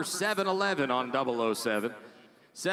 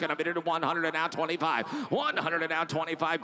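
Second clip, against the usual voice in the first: fast rhythmic auction chant; auctioneer. Real, not acted. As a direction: rattle off the bids like an auctioneer. Auction bid calling.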